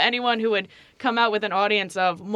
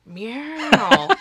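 actor-style drawn-out meow, higher; Owen Wilson's cat; 6 semitones higher, 4 dB louder than usual. Acted. Guest finishes her joke, cat with the actor's sound. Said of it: "Owen Wilson's cat".

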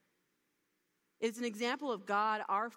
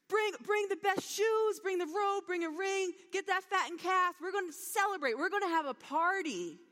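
joyful calling out; 8 semitones higher, 5 dB louder than usual. Retold or imitated. Father calls servants to prepare a party, joyfully calling out. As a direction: sing it out like an overjoyed father. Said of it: "He was singing out to the servants".

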